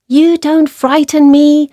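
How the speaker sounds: high, piping mouse voice, not hushed; quiet little mouse. A narrator's reading. Little mouse to sleeping lion, high brave mouse voice. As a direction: play a small brave mouse, pitched well up. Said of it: "said the little mouse quietly".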